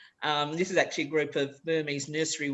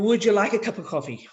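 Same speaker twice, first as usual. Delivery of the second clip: whole phrase on one breath, linked; one smooth exhale; 4 semitones higher, 5 dB louder, faster than usual. Shown as a demonstration. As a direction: breathe in, then release "Would you like a cup of coffee?" in one flow. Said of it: "take a deep breath in".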